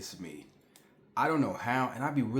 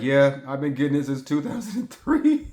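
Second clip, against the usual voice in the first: exasperated reply; 3 semitones higher, 9 dB louder than usual. Retold or imitated. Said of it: "I'm just like".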